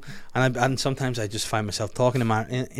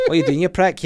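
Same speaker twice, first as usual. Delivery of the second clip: louder, higher mock Glaswegian; Glasgow accent; 4 semitones higher, 5 dB louder than usual. Shown as a demonstration. Showing how Glasgow talks, insulting Glaswegian accent. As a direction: challenge them like a cross Glaswegian. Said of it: "Glasgow's a wee bit more".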